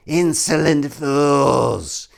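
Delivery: very slow, booming devil voice; devil proclamation. Acted. Devil threatening the paladins, slow and menacing. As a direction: proclaim doom like a demon. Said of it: "The devil proclaimed".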